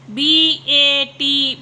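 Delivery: slow letter-by-letter spelling. Shown as a demonstration. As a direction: spell A P slowly, each letter apart. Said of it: "teacher spells word slowly".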